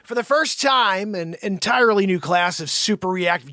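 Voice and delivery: usual speaking voice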